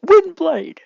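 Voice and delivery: high, loud shouted attack name; battle cry. Acted. Izuku shouting his attack at the sludge villain. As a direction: yell the move name as you strike. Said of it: "yells out".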